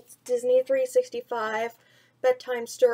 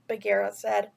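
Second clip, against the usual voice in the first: put-on gentle storyteller voice reading to children; bedtime story reading. A narrator's reading. Vlogger reading a picture book to kids, storytelling voice.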